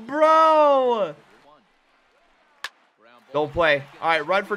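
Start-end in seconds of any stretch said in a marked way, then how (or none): 0.1-1.1 high, loud drawn-out cry of disbelief; one long, loud wail; stunned outcry. Real, not acted. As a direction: cry out in amazement. Reaction to a stunning play.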